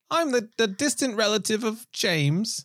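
high, slow put-on character voice; mock heroine introduction. Joking as a new character related to Bond, mock introduction. Continues the imagined Bond newcomer.

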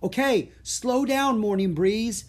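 high, loud call to ease up; yelled command. A narrator's reading. Boy to charging elephant, yelled command. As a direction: yell for a runaway to slow. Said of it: "yelled Jack".

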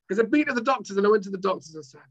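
ordinary talk

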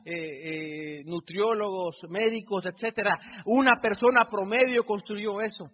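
loud, rousing stage address to the crowd; preaching at full force. Acted. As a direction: preach it big, nearly shouting.

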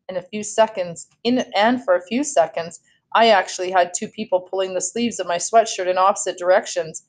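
ordinary delivery

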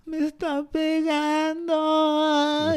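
put-on high, slow childish whine, a kid tattling; whiny kid voice. A kid complains to the teacher about being hit, in a high whine. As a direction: whine it like a little kid telling on a classmate.

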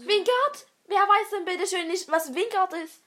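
loud, high-pitched indignant outburst. Real, not acted. Player protesting that everyone knows this, loud indignation. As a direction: exclaim it in loud disbelief. Mock indignation at being doubted.

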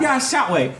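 high chirpy shop lady; shopkeeper voice. Acted. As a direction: point them the way in character. GM voicing shopkeeper NPC.

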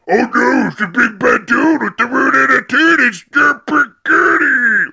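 sing-song taunt copying the intro; mock the theme line, drawing out Derpy Gertie; teasing sing-song imitation. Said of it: "Stop it, Big Bad Billy".

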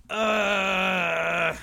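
long effortful groan; strained groan. Real, not acted. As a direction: groan while heaving yourself up. Grunts while getting up from seat.

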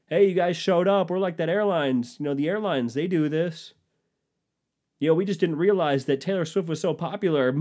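high, loud sheepish excuse; sheepish company. Acted. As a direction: play innocent, loud and high. Acted Ticketmaster excuse.

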